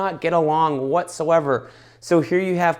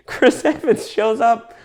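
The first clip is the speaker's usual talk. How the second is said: high, drawn-out words after a laugh; laughing delight; 10 semitones higher, 3 dB louder, slower than usual. Real, not acted. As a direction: savour the surprise through laughter. Delighted recall of surprise cameo.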